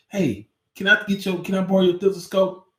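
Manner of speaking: a tripping kid playing doctor; eager ask.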